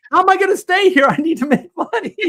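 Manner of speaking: high, panicky mock protest; retold desperation. Broke young artist protesting an unpaid internship, desperate. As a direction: play up the broke kid's plea, pitched high.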